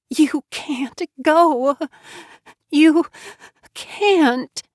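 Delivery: high, desperate, grief-stricken begging; anguished pleading. A narrator's reading. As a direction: beg a dying friend to stay. Woman begging dying friend to stay.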